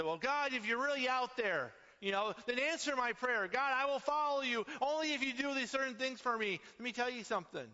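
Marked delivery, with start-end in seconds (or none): 0.0-6.6 high, loud, whiny demand on God; demanding complainer. Retold or imitated. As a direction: mimic people bargaining with God. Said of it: "I hear people say".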